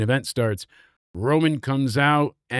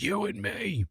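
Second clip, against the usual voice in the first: quiet menace; 6 semitones higher, 9 dB quieter than usual. Retold or imitated. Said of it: "He's like".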